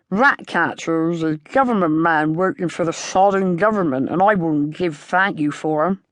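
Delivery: a put-on rustic farmer voice, grumbling; gruff farmer. A narrator's reading. Old farmer scorning the rat catcher, surly country voice. Held farmer voice, narrator reading.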